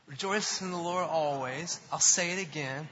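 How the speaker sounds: loud, measured rallying call; emphatic call to say it together; leading a recitation. Shown as a demonstration. Leading congregation in reciting the verse.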